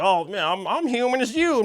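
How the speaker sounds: high-pitched mocking whine of a yapper; mocking yapping. Critic mimicking Superman yapping on to Lex, mocking. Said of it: "just yap and yap and yap".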